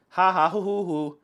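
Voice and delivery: spoken, put-on gloating laugh; mock cocky laughter. Warning players about overconfidence, imitating cocky gloating. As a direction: imitate someone far too pleased with themselves. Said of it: "when you get too cocky".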